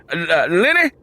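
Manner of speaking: high, loud stammered call; calling wife. Acted. Father calls his wife from the door, loud and stammering. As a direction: call out to her from outside.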